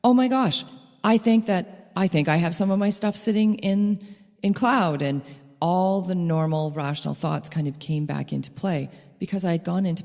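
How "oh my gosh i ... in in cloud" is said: higher, louder hopeful rush; sudden realization. Retold or imitated. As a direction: catch yourself as relief dawns. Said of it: "I went".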